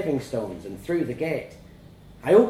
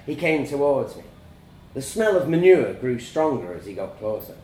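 ordinary delivery

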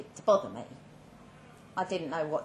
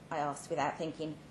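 ordinary delivery, nothing marked

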